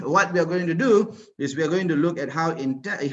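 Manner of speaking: ordinary delivery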